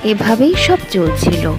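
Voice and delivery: ordinary delivery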